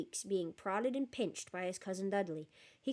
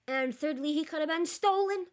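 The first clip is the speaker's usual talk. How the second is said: much higher, louder outraged rant; indignant; 7 semitones higher, 7 dB louder than usual. Real, not acted. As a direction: protest in mock outrage. Reader ranting about the story.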